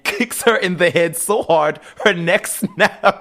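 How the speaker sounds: high, slow, laughing through the words. Real, not acted. Retelling the fight to a friend, laughing hard. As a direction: laugh out the gory punchline. Laughing while telling gory end.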